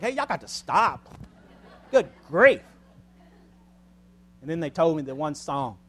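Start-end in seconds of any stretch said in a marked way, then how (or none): 0.0-2.6 mock scolding. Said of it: "I'm like".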